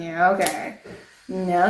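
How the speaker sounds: ordinary delivery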